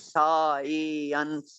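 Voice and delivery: "science" stretched, low, through the gliding vowel; drawn-out glides. Shown as a demonstration. Teacher asking why it is one syllable, "science" drawn out. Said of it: "इतनी glides के बावजूद".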